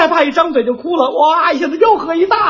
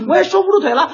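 ordinary talk, nothing marked

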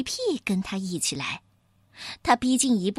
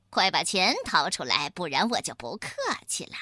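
low, growled threat, tailing off softer; low menacing robber; 6 semitones lower, 3 dB quieter than usual. Acted. Robber ape threatening the rabbit over her money. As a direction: lean in and threaten.

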